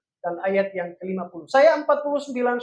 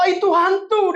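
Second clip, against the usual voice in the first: frightened cry; 8 semitones higher, 4 dB louder than usual. Acted. Disciples cry out seeing ghost.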